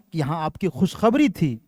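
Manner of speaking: ordinary delivery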